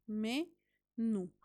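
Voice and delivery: menú broken into two syllables, me, nú; split into syllables. Shown as a demonstration. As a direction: separate me and nú with a pause. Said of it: "Esta palabra tiene dos sílabas".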